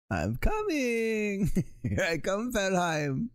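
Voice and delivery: put-on high, drawn-out German-accented call; hammed-up German calling, breaking into laughs; movie German accent. Papa coming to find Valheim, high mock German accent. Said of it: "movie version of a, of a German accent".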